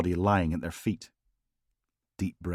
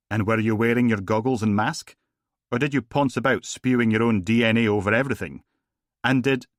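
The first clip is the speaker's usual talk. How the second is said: pompous posh Scottish barrister, higher and louder; posh Scottish accent; 4 semitones higher, 6 dB louder than usual. Acted. Logan mocking a TV detective in a posh Scottish accent. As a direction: grill the witness in a lofty posh Scottish accent. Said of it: "he put on a posh Scottish accent".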